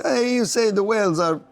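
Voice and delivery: high, slow imitation of an Italian caller; Italian accent. Italian researcher querying an economist about whales, imitated Italian accent. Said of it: "in his Italian accent".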